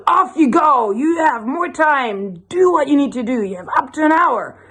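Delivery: high, commanding pirate voice; hammed-up pirate giving orders. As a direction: send them off in a high pirate voice. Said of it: "so the pirate goes".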